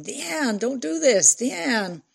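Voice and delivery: high-pitched, urgent calling out; shouting while running. A narrator's reading. Fran calls after the fleeing girl, shouting. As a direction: call after someone running off, pleading. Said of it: "shouting while running".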